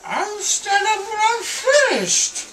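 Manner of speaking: high, loud wail; crying declaration. Real, not acted. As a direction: sob it out defiantly.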